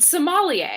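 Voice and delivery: "sommelier" with an open ah, mocking the error; mispronunciation. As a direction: say "sommelier" with an emphatic MAH. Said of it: "not a ma".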